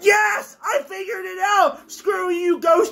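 high victorious yelling at the ghost; triumphant shout. Real, not acted. Player defeating the ghost, shouting in high triumph. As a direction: gloat at a beaten enemy.

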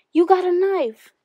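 high, loud, slow scream of terror; terrified scream. Acted. Scared Howard screams at the narrator in terror. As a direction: shriek it out in sheer terror. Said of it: "let out a scream of sheer terror".

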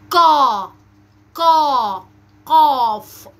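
قا and قاف loud and long, from the back of the tongue; forceful heavy qaf. Shown as a demonstration. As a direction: drill a strong, drawn-out قا. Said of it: "النطق بقوة أثناء نطق القاف". (قاف مفخمة بقوة)

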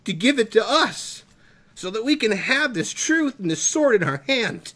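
impassioned preaching, pushed and emphatic; choked-up conviction, leaning hard on key words; emotional. Real, not acted. Preacher honoring those who gave up their lives, emotional. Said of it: "I'm getting a little emotional".

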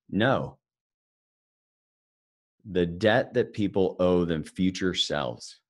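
slow dry deadpan Ben Stein, a light imitation. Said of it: "in his very dry way".

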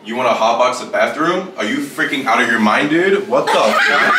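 hammed-up American dude accent; put-on American accent, ending on an incredulous laugh. Shown as a demonstration. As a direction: play it as an outraged American dude. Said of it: "try to say it Americanese".

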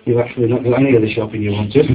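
ordinary delivery

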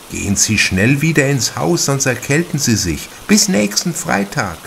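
a concerned hostess pleading gently; worried mother. A narrator's reading. Mother sends visitor back inside.

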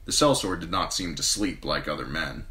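ordinary delivery, nothing marked

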